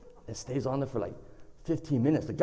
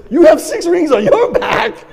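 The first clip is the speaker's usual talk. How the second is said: very loud, high, slow disbelief; horrified shriek; 12 semitones higher, 14 dB louder than usual. Retold or imitated. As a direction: shout it back, much higher, appalled. Brother shrieks back the same news.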